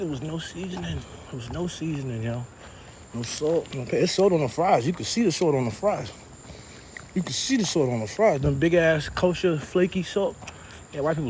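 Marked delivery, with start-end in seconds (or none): none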